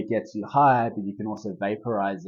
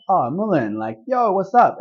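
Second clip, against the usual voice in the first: higher, louder delighted hello to the plant; cheery greeting; 9 semitones higher, 9 dB louder than usual. Retold or imitated. Pilgrim greeting the mullein plant on the Camino, delighted and higher. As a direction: greet the herb like an old friend, voice jumping up. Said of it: "And I was like".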